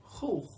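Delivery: Khuch with a rasping kh as in German Bach; rough throaty kh in Khuch; guttural Kha. Shown as a demonstration. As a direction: scrape the kh at the back of the throat. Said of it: "Sounds a lot like Bach in German".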